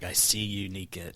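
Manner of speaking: low, soft creepy doll voice; sinister Chucky. Retold or imitated. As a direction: menace her quietly like a killer doll. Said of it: "sinister look on his face".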